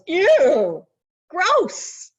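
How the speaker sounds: high, loud grossed-out ew; disgusted ew. Retold or imitated. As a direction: recoil like a kid who finds it gross. Disgusted reaction as a child.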